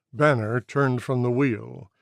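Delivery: ordinary delivery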